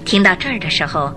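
ordinary talk

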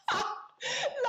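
bursting into high laughter; laughing at herself. Real, not acted. Laughs after story beat.

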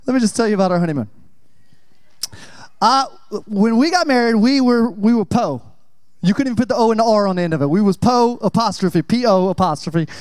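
ordinary talk, nothing marked

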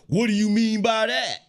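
high, loud indignant snap. Retold or imitated. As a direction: challenge it, offended and sharp. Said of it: "And she'll be like".

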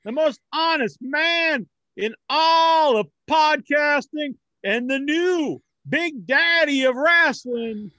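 loud, slow wrestling promo; hype introduction. Acted. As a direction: boast it like a ring announcer. Host self-introduction in hype voice.

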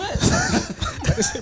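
high shrieking laugh; laughing after dropping something; burst of laughter. Real, not acted. Laughs after thing fell.

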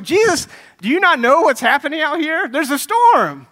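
high, loud, incredulous cry; panicked shout. Acted. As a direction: shout it to wake a sleeper in a storm. Panicked disciple waking sleeping Jesus.

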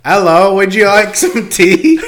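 loud, high, drawn-out mock British; British accent. Shown as a demonstration. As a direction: put on a hammy British tea-time accent. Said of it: "if I try to do a British one".

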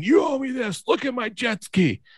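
high whiny voice for the angry jet ski man; imitating a stranger demanding payment, high and whiny; mocking the jet ski guy. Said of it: "And he's saying".

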